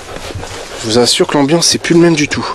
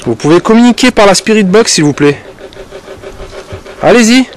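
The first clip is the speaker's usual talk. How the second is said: calling out; 7 semitones higher than usual. Real, not acted. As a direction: call out into the night. Calls out to spirits.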